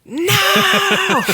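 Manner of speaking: high, loud indignant No; real outraged cry. Real, not acted. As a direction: shout down the idea. Reacting to Yakko comparison.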